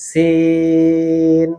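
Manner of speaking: "সিন" with a very long, level ee; long alif stretch. Shown as a demonstration. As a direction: hold the vowel of "সিন" on one steady note. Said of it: "এভাবে সিন হরফকে আমরা এক থেকে চার আলিফ টেনে বলবো".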